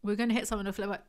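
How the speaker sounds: "We were going to hit something or flip over" gabbled very fast; rapid-fire read; rushed. Shown as a demonstration. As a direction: race through it as one run-on. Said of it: "Just combine the sentences".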